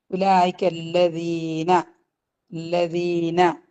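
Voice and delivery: slow tajweed recitation; Quran recitation. Shown as a demonstration. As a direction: chant it slowly and gently. Teacher recites Quran phrase.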